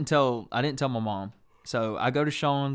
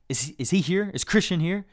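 higher-pitched mock mom questioning; imitated demanding mom; 7 semitones higher, 4 dB louder than usual. Mom at friend's door looking for son, imitated urgent questioning. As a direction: press the question like a mom hunting her son. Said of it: "And she was like".

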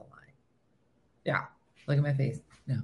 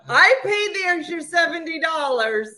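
laughing mock boast; 8 semitones higher, 4 dB louder than usual. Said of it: "And you didn't say".

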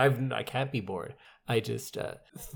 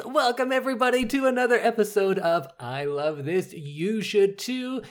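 show intro announcer; 7 semitones higher than usual. Acted. Podcast intro, show title.

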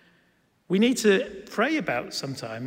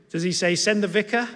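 higher-pitched rhetorical question, rising at the end; raised, incredulous mock question; 4 semitones higher, 4 dB louder than usual. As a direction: toss out an absurd suggestion. Mock guesses at what Nehemiah said.

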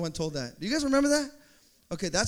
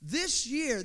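ordinary talk

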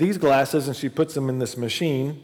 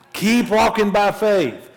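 higher, louder, urging; louder exhortation; 7 semitones higher, 5 dB louder, slower than usual. Shown as a demonstration. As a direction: push it out to the congregation.

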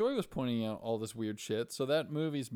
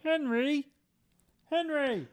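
very high, loud put-on call; hammed-up high call of a name; mock calling out; 7 dB louder, slower than usual. Calling out to Henry in character.

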